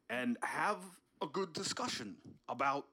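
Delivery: ordinary talk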